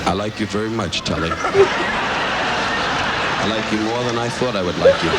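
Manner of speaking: low, slow, drawn out like a love confession; mock-sincere affection, dragged slow; mock tender. Comic tells a guest he likes him, mock tender.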